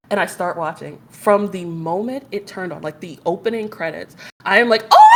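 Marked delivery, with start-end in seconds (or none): 4.9-5.0 reenacted high "oh!" outburst; loud gasped squeal. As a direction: let out a loud fangirl "oh!". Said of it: "I'm like, out loud".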